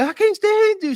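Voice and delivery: high, loud mock outburst; mock wife yelling at her man; mock angry wife. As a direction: squeal it like a fed-up wife. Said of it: "And eventually".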